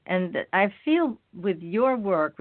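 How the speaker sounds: ordinary talk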